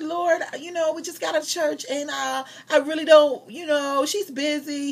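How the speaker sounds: high-pitched pleading excuse, retold; making excuses. Acts out making excuses to God.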